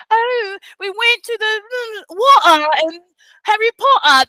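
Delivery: high, exaggerated posh British accent; mock British accent. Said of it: "how the British accent came".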